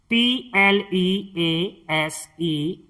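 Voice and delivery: letters spelled out slowly, one by one; slow teacher spelling. Shown as a demonstration. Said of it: "इसे हम पढ़ते हैं please".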